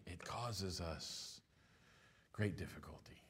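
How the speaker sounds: a grave near-whispered warning; hushed. Real, not acted. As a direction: drop to a hush for weight. Sermon point, dropping to hush.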